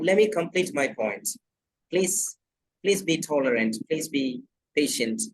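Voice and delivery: ordinary delivery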